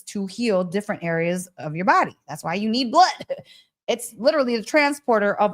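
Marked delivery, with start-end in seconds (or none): none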